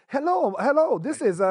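put-on high-pitched caller with English accent; strong English accent. Imitating a scam caller opening her call, English accent. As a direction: mimic an English-accented woman on the phone. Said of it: "the chick with a strong English accent".

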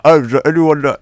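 high, loud muffled words through food; squeaky call with a mouthful; talking with a mouthful. Real, not acted. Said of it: "Can't talk a mouthful".

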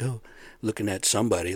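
ordinary delivery

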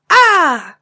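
sudden surprise shout. Acted. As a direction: jump out and shout Ah. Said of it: "jumped out ... and said".

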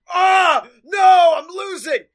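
loud, high mock scream of defeat; The Miz wailing. Retelling the Miz losing his game, mock wailing. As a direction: yell it like a hammed-up sore loser.

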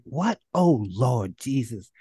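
shocked grandma, slow on Lord Jesus. Retold or imitated. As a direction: grandmother hearing he's in New York. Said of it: "She's like".

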